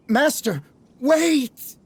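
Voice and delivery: desperate call. Acted. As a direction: shout after him, pleading. Calling after departing lord.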